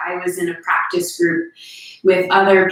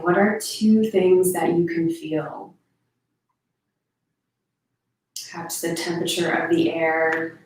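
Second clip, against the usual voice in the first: guided meditation; 2 semitones lower than usual. Real, not acted. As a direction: gently guide listeners through their senses.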